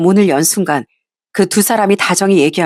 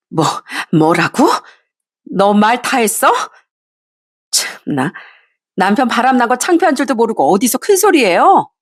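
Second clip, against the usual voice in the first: furious threat; 4 semitones higher than usual. Acted. Wife threatens mistress.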